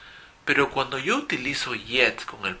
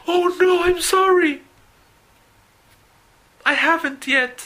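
voz muy aguda y fuerte de secretaria que se disculpa; secretaria apenada; 7 dB louder than usual. Acted. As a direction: hace de Mindy, apurada y pidiendo perdón. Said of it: "Ella te mira". (la secretaria se disculpa con su jefe, voz aguda y apenada)

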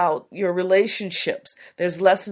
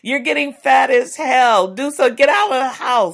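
loud, fast, exasperated nagging; nagging spouse; 5 semitones higher, 6 dB louder than usual. Retold or imitated. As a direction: nag your partner, fed up. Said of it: "the mate just saying".

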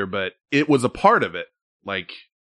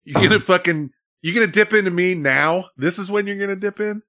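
raised, incredulous pitch; indignant challenge; 8 semitones higher than usual. Real, not acted. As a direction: push back in disbelief. Mock quarrel with co-host.

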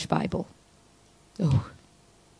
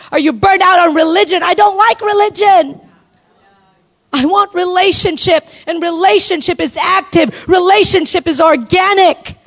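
high, loud impassioned declaration; full-force preaching; 12 semitones higher, 5 dB louder than usual. Real, not acted. Preacher declaring to women what she wants from God, full-force preaching. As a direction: cry it out to the crowd at full pitch.

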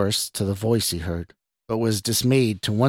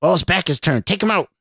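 urgent goading; 9 semitones higher, 4 dB louder than usual. A narrator's reading. Stranger urges attack behind boss's back, urgent. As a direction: voice climbing high on the command.

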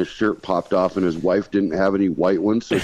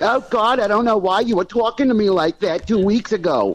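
mocking whiny voice; 11 semitones higher than usual. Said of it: "she's like".

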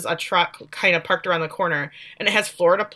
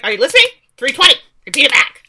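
loud, high, punched commands; stern mother; 6 semitones higher, 8 dB louder, faster than usual. Retold or imitated. Mother drilling dazed daughter, loud and stern.

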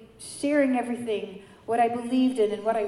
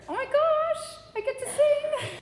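very high thrilled squeal; overjoyed, high singsong outburst; giddy excitement; 3 dB louder than usual. Retold or imitated. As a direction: squeal it out, pitched way up. Retold joy at being asked to cantor.

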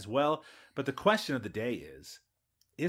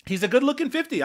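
teasing concession; 13 semitones higher, 7 dB louder, faster than usual. Real, not acted. Host teasing about actor's looks.

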